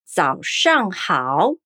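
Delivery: 早上好 said very slowly, syllable by syllable; slow Mandarin. Shown as a demonstration. Teacher models good morning in Mandarin, slow and clear. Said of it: "como dizemos bom dia?".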